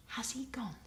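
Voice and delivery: hushed, nervous whisper. A narrator's reading. Marcia asking Spider, in a nervous whisper. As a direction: whisper so nobody hears. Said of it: "whispered Marcia nervously".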